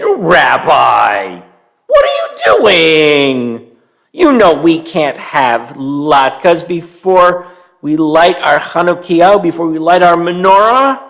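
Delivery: put-on a slow calling-out old lady scolding. Old Bobby Bryna to the sniffing bear, slow mock scolding call. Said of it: "Bobby Bryna said".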